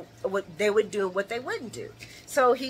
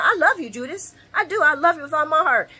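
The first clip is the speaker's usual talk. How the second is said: higher and louder, hammed-up tenderness to a traitor; mock loving farewell; 7 semitones higher, 5 dB louder, faster than usual. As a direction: say it like a playful, grand goodbye. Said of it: "I'm telling her".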